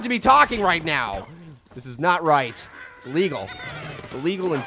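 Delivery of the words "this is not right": ordinary talk, nothing marked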